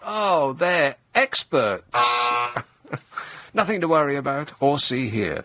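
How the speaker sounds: slow, heavy sarcasm on experts; sarcastic. Pretend. Comedian mocking the new IT specialists, slow and sarcastic. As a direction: drag it out with mock admiration. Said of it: "sarcastic praise".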